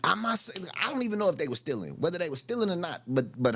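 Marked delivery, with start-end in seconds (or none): none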